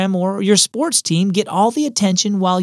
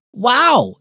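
high, bright "Wow!" of delight; girl thrilled by the bullfrogs; excited exclamation; 7 semitones higher, 4 dB louder than usual. A narrator's reading. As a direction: gasp it out in wonder. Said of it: "exclaimed Julia".

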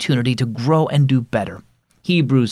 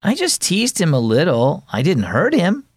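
put-on higher, defensive boy's protest; objecting; 7 semitones higher than usual. Boy protesting to his mom, lightly defensive. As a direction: brush it off, a little defensive. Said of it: "Carter objected".

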